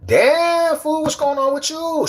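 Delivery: very high, squeaky-pitched exclamation; high-pitched greeting. Pretend. Streamer hailing a viewer from Toronto, high-pitched greeting. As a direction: jump way up in pitch to greet him.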